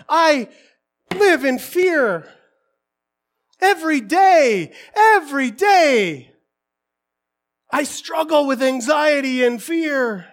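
loud, slow, drawn-out admission; emphatic preaching confession. Real, not acted. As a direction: pound out each phrase to the congregation.

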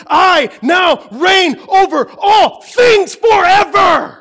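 high, shouted pulpit proclamation; loud, impassioned preacher at full volume; full-force preaching. Real, not acted. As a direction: thunder it out to the congregation, stretching the key words.

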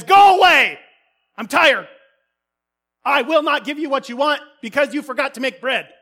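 loud, high furious yell; angry shout. Acted. Woken neighbour shouts at the friend to go away. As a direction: bark him away from the door.

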